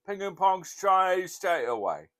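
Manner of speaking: high, slow, loud put-on voice; mock phone answer. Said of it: "he'd answer the phone".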